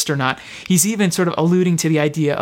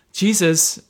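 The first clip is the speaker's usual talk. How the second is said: ordinary delivery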